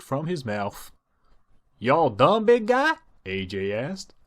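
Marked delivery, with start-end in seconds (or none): 1.8-2.9 high, louder playful question; higher country sister voice. Acted. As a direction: lift it up high and teasing. Applejack asks her brother.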